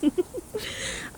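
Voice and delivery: high, rising wicked titter; gleeful villain's chuckle; witch's mocking giggle. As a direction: giggle at their hurry. Witch laughs at stumbling victim.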